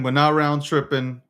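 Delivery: ordinary talk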